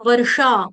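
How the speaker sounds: वर्षा with a clear "sh"; careful "varsha", hushing sound in वर्षा; correct sh sound. Shown as a demonstration. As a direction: give वर्षा a full ष. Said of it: "वर्षा नहीं".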